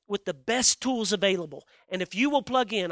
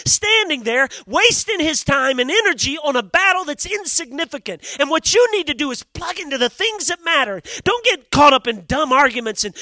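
loud, high, driving sermon voice; full-force pulpit preaching; fiery preaching; 5 semitones higher, 8 dB louder than usual. Acted. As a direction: preach it hard to the crowd.

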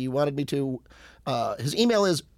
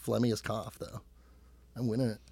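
whispering; 5 semitones lower, 9 dB quieter than usual. Acted. Whispered joke.

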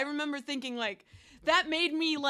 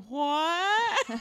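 surprised what; 7 semitones higher than usual. Retold or imitated. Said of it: "Mo going".

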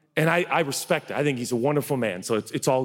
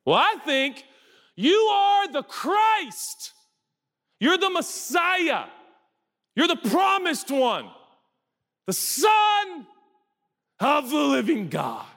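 high, slow, ringing declaration as Peter; Peter's eager answer; slower than usual. Acted. Peter answering Jesus, a high, slow awestruck proclamation. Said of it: "so he's like".

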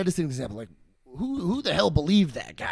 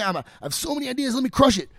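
higher, fast and eager; eager pleading; 7 semitones higher, faster than usual. Retold or imitated. Guy begging Edison to let him work, eagerly. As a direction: sell yourself, keen to crush it.

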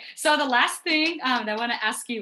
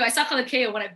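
normal talking voice

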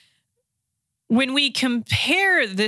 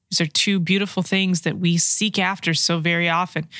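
ordinary delivery

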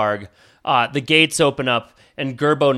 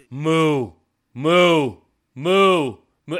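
mooing chant; 3 semitones higher, much slower than usual. Acted. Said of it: "And then he goes".

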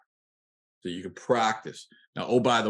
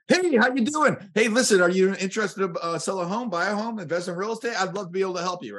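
pushy car salesman; 10 semitones higher than usual. Retold or imitated. Coach mocks a high-energy agent, pushy car salesman pitch. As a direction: gush at them like a used car salesman. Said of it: "You sound like a used car salesperson".

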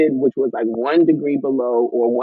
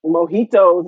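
ordinary talk, nothing marked

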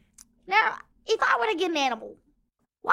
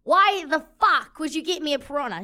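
normal talking voice

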